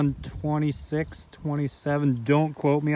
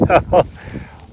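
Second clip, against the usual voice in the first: breathless happy laugh; excited laugh; 6 semitones higher, 6 dB louder than usual. Real, not acted. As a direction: laugh with thrill. Excited after first ride.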